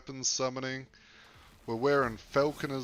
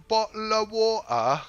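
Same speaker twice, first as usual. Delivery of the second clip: British accent, higher and louder, on request; a hammed-up British "bottle of water"; 9 semitones higher, 8 dB louder than usual. Shown as a demonstration. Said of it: "I can try".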